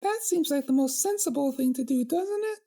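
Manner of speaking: very high, puzzled pony princess; squeaky high and baffled; perplexed princess. Acted. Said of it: "asked with a perplexed expression".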